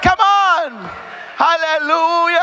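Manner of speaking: usual speaking voice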